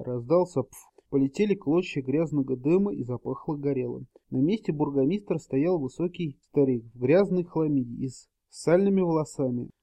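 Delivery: usual speaking voice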